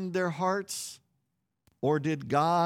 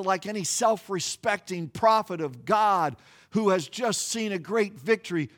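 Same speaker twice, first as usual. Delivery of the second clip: ordinary talk, nothing marked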